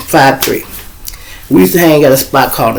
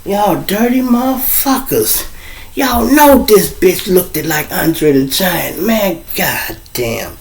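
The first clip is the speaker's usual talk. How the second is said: slow, drawn-out mock scolding; mock outrage; 5 semitones higher, 3 dB quieter, slower than usual. Scolding the laughing friends for the prank, slow mock outrage.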